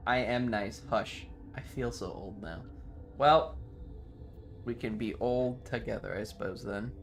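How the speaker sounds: ordinary delivery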